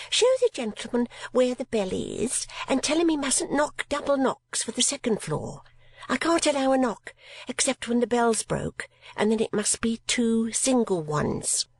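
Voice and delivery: high, prim lady calling down; high female voice. A narrator's reading. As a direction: voice a fussy landlady, high. Servant relays instructions.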